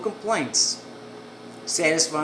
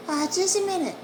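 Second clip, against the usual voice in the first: a higher woman's voice asking for a moment; woman behind the door; 14 semitones higher than usual. Retold or imitated. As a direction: tell him to wait, a little less hostile.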